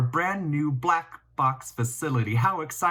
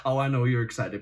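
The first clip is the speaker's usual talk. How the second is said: usual speaking voice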